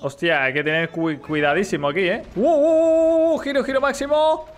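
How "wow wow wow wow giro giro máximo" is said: excited shouting. Real, not acted. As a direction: cry out as the ride swings hard. Thrilled reaction to a wild ride turn.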